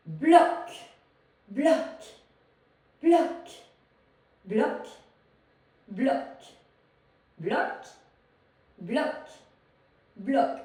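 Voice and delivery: loud single word on each beat; loud, staccato workout count; punchy exercise cue. Real, not acted. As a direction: punch out each cue sharply, leaving a gap. Calling beats for an accented movement.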